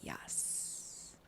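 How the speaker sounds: whispered, hissing yes; whispered agreement. Real, not acted. Co-host backing up the recap, whispered. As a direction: breathe the word out in a whisper.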